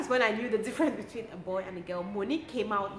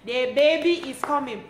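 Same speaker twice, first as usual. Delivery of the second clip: midwife calling the nurses, louder; 4 semitones higher, 6 dB louder than usual. Retold or imitated. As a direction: call everyone in urgently, raised voice. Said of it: "Started calling all the nurses".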